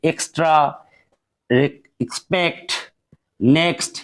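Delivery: slow careful ks in এক্সট্রা, এক্সপেক্ট, নেক্সট; teacher modelling ks. Shown as a demonstration. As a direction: stretch out each ks cluster. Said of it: "তাহলে উচ্চারণ করতে হবে কস".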